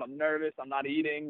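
quiet anxious confession; nervous and quiet. Real, not acted. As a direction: hush it like reliving the nerves. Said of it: "I'm freaking out".

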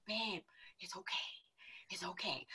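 hushed, whispered calming of a partner; soothing whisper. Retold or imitated. Girlfriend calming her livid boyfriend, soothing whisper. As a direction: whisper it to settle him down.

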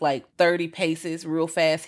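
normal talking voice

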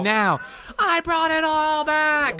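high, slow gloating voice; triumphant boast. Acted. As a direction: crow it slowly, very high. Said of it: "as if he was like".

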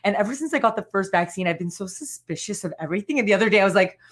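normal talking voice

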